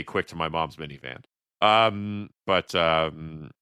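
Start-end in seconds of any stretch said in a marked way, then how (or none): 0.0-1.2 low, soft mutter of the line; muttered quote. Retold or imitated. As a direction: mumble it under your breath. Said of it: "when you say".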